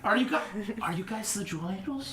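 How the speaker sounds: suddenly soft, awed asking; hushed question. Acted. Bartender asking newcomers who they are, hushed. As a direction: drop to a hush to ask.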